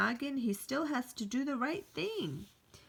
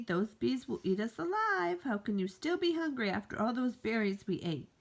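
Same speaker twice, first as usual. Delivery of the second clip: usual speaking voice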